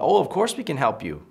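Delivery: high, willing, helpful reply; eagerly obliging. Retold or imitated. Passers-by answering a woman's request, eagerly obliging. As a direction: gush with readiness to help. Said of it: "Now people are".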